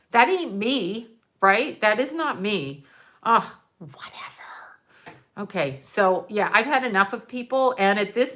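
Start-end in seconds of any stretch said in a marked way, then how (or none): 3.8-4.4 whispered, fed up; a whispered ugh, whatever; whispered brush-off. Real, not acted. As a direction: let it go under your breath. Dismissing her angry friends.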